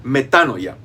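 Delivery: metanoia stressed on the first a, me-TA-noia. Shown as a demonstration. Teacher to students, metanoia with stress on the first A. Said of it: "no la dije equivocadamente, se dice metanoia".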